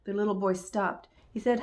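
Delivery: usual speaking voice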